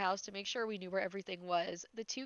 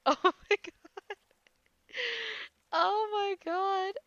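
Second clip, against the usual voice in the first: helpless laughter, almost crying; laughing hard; 12 semitones higher, 7 dB louder, slower than usual. Real, not acted. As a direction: crack up until the voice breaks. Cracking up at the story.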